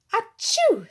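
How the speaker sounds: loud high achoo; sneeze. A narrator's reading. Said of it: "sneezed the queen".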